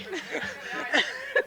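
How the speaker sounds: real laugh breaking out, high; laughing at herself; bursting into a high laugh. Real, not acted. Laughing at her own story.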